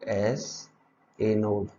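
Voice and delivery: एसए नोड stretched very slowly, एसए drawn long; drawn-out label. Shown as a demonstration. Teacher labelling the diagram, एसए नोड very slow. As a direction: drag एसए नोड out as you point. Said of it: "मैं दूसरे इसको use कर लेता हूँ".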